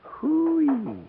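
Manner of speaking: scoffing. Acted. Yates scoffing at Joby's threat, mocking. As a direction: laugh off the threat.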